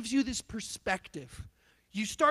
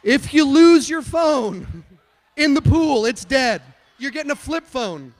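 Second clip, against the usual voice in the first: preaching shout; 3 semitones higher, 15 dB louder than usual. Real, not acted. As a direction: hammer the warning out at full volume.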